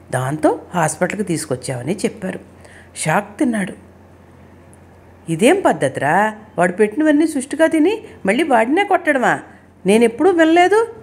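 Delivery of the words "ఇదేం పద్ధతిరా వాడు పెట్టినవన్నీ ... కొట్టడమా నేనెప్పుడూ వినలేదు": indignant rebuke climbing in pitch; angry scolding. A narrator's reading. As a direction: scold the boys in outrage, short of a full shout. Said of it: "అంటూ అరిచాడు రాజారాం". (కోపంగా మందలిస్తూ)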